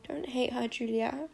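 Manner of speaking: quiet, pleading, near tears; soft and tearful. A narrator's reading. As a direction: plead gently through tears. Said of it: "his eyes fill too".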